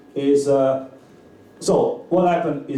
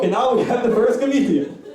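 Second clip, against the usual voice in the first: announcer intro; 7 semitones higher than usual. Acted. Host welcoming the comedian to the stage in an announcer call. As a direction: bring the comedian on like a club emcee.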